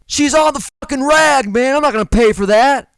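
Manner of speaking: high, loud mock yell of the client. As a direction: bellow it, fed up and indignant. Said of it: "And then he yells".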